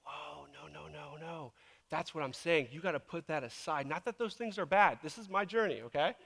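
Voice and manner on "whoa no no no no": hushed, low string of noes; gentle halting. Retold or imitated. God answering the preacher's plans, hushed and gentle. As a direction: softly stop someone in their tracks.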